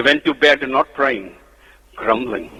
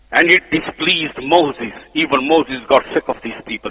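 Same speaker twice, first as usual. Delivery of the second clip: normal talking voice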